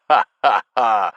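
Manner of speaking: loud burst of laughter; laughing. A narrator's reading. Stinky laughing loudly at the narrator's word.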